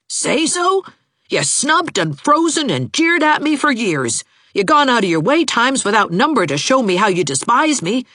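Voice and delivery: high, indignant tirade; heated accusation. A narrator's reading. Heated lovers' quarrel, accusing.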